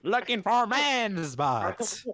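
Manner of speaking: slow mock-heroic declaration; catchphrase impression. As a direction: stretch it out like a proclaimed catchphrase. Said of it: "Do, like, a FaceTime saying".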